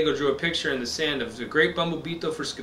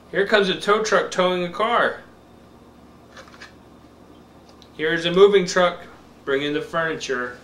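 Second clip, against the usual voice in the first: slow, bright picture-book reading; reading to a toddler; 2 semitones higher, 3 dB louder than usual. Acted. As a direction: read it slow for a little one.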